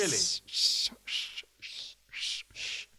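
whispered sh- with the tongue tip placed on purpose; testing the s and sh sounds under the breath. Shown as a demonstration. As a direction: hiss sh- sounds softly to test the tongue. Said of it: "does your tongue tip go up or".

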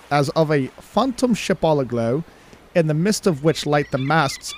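amateur British accent reading. Shown as a demonstration. Co-host reading a captain's log on request, attempted British accent. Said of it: "try to do a British accent".

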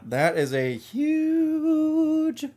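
"huge" stretched very long and high; drawn-out emphasis on huge. Real, not acted. Exaggerating the size of the foam.

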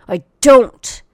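loud stubborn insistence; emphatic shout. Real, not acted. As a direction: bark it as the final word. Ranting at a rude viewer.

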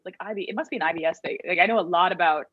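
ordinary delivery

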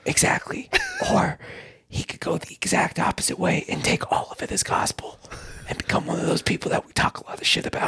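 whispered asmr role play. Pretend. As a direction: whisper as if a child might hear. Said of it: "we're just gonna whisper".

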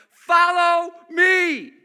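slow, loud proclaimed command; exclamation point. Shown as a demonstration. Preacher voicing Jesus to Peter, loud emphatic command. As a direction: boom it out slowly with an exclamation point. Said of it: "With an exclamation point".

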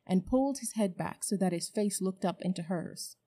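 usual speaking voice

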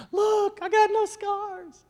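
very high, slow joyful exclamation; imitating a delighted healed girl. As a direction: squeal it like she's just been healed.

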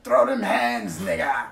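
loud Popeye-pirate growl calling out a fight; hammed-up pirate challenge; pirate shout. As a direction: bellow it like a sailor spoiling for a brawl. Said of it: "been on a ship, and be like".

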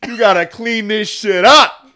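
loud high bro voice; frat bro. Pretend.

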